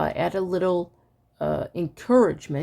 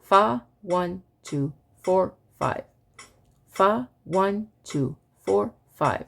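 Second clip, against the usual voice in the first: evenly spaced counting, a bit quicker; faster metronome recitation; slower than usual. Shown as a demonstration. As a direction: chant in time with a faster click. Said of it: "there's a little bit of a clip here".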